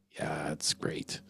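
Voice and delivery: slow, dry whispered aside; sarcastic whisper. Real, not acted. Mocking self-care talk, a slow sarcastic whisper. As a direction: whisper it like you don't buy it.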